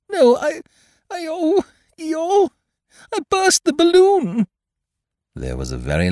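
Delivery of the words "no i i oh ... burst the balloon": very high, squeaky, halting confession; distressed Piglet. A narrator's reading. Piglet confessing he burst the balloon, distressed squeak. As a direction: stammer it out, louder on the last words.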